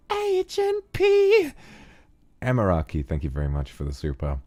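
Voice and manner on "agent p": very high, very slow, stretched out; cartoon call. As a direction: squeak out a cartoon name slowly.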